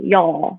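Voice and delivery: "y'all" said low, like Y-A-W; demonstrating Southern y'all. Shown as a demonstration. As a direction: model "y'all" with an AW vowel. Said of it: "it's like Y A W".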